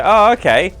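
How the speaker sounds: high, loud pretend "aha" moment; mock realisation. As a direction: act out suddenly catching on. Voices player catching on.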